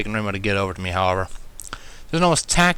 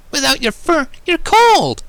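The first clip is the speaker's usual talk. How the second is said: playful swoon; 11 semitones higher, 5 dB louder, slower than usual. A narrator's reading. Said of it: "she swooned playfully".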